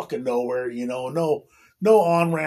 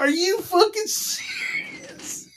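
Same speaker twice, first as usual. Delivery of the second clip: much higher, slow drawn-out what the fuck; stunned disbelief; 11 semitones higher, slower than usual. Retold or imitated. Reacting to a roommate who won't use condoms, aghast. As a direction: stretch it out, high and aghast.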